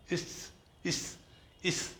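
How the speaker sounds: इस hushed, with a long hiss; slow, soft 'isss' for इस, each one apart; isolated 'is' sound. Shown as a demonstration. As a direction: sound out इस very softly, stretching the s. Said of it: "इसकी आवाज़ आ रही है".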